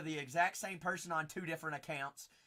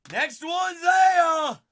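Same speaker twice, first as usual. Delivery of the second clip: drawn-out, loud excited shout; hype shout; 12 semitones higher, 16 dB louder, much slower than usual. Real, not acted. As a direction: belt it out at the hit. Hyped callout during break.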